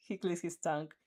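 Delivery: plain reading voice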